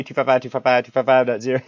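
fast auctioneer patter. Shown as a demonstration. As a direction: rattle it off like an auctioneer. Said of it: "you sound like an auctioneer".

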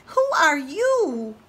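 very high, tiny tadpole voice. Acted. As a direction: squeak it like a baby tadpole. Tadpole asks.

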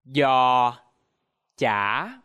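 "Giò chả": loud low giò, then a dipping chả; Vietnamese pronunciation. Shown as a demonstration. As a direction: model "Giò chả" word by word. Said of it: "now repeat after me".

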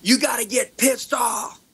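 a creator yelling into the camera; fired-up shout. Shown as a demonstration. Coach showing a zoomed-in clip, fired-up shout. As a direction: shout it, pissed off and loud. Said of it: "and then it's like".

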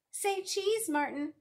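high, loud playful call; bright sing-out in a high child voice; child playing photographer. A narrator's reading. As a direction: call out to pose the subject.

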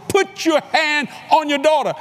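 high, slow, drawn-out order; re-enacted insistent holler; hollered command. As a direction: holler it word by word down the phone. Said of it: "I had to holler at him".

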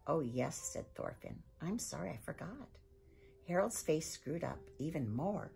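lively storybook read-aloud; reading to children. A narrator's reading. Librarian reading chapter one to children, lively storytelling. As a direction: read it like a bedtime story for kids.

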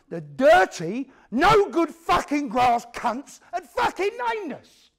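loud, high, slow spitting rage; furious outburst. Real, not acted. Teller raging at the grasses who named them, slow furious shout. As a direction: let the contempt explode on every word.

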